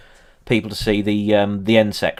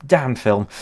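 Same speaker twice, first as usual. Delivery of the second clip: normal talking voice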